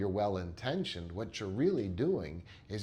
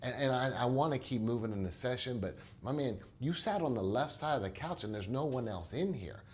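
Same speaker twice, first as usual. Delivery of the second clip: usual speaking voice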